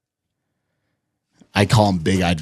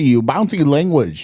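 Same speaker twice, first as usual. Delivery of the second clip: bouncy mock Vietnamese accent; 6 semitones higher, slower than usual. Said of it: "It's bouncy".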